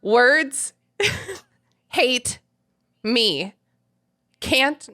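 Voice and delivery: halting, pausing after every word; staccato reading, word by word. Shown as a demonstration. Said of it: "I'll speak in a little staccato".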